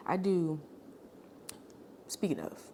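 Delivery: usual speaking voice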